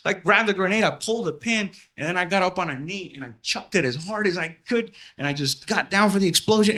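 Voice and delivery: usual speaking voice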